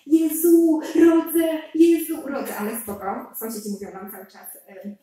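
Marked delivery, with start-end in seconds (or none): 0.1-2.6 loud, high cries of labour pain; panicked birthing scream. Retold or imitated. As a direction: yell it down the whole stairwell. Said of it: "na cały pion po prostu krzyczę".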